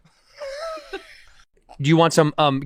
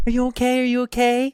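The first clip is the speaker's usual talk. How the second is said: mock concerned girl voice; 7 semitones higher than usual. Mocking how hot chicks react.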